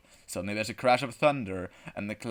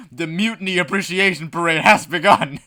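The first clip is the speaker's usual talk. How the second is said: loud, raised announcer-like narration; grand announcement; 7 semitones higher, 12 dB louder than usual. A narrator's reading. Narrator announces the parade has begun, grand and loud. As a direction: proclaim it to the whole crowd.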